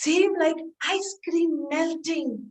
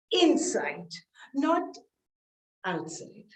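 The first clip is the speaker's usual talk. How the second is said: whispered correction; 2 semitones lower, 5 dB quieter, slower than usual. Retold or imitated. Instructor correcting her quietly.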